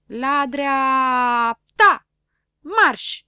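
loud, slow drawn-out drill command; military command. Acted. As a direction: bark it like a drill sergeant. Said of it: "comandă Șurubel". (comandă militară, tare și tărăgănată)

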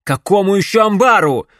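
loud, impatient demanding shout. A narrator's reading. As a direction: demand it loudly. Hero demands answers.

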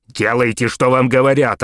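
loud, commanding yell. A narrator's reading. Mayor yelling at Maxwell to obey. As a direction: bark the order. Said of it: "орал мэр".

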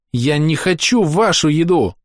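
put-on high, slow pouty little girl; stubborn pouting child. A narrator's reading. Girl refuses the adults' food, high and pouting. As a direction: pout it out stubbornly. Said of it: "упрямо дует губы Амелия".

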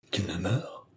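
s'écrier faiblement, tout bas; voix faible. A narrator's reading. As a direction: question murmurée, nettement plus douce, qui remonte à la fin. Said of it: "s'écria-t-elle d'une faible voix". (d'une voix faible et interrogative)

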